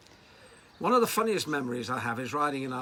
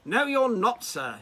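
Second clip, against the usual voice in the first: sharp high retort, louder; shouted down; 11 semitones higher, 9 dB louder, slower than usual. Retold or imitated. Colonel shouting down the young man, loud and high. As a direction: cut him off with a loud, high no. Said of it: "was shouted down by this tiny man".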